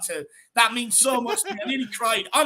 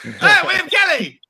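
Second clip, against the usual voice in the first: excited call; 8 semitones higher, 6 dB louder, slower than usual. Real, not acted. Greeting a new guest loudly.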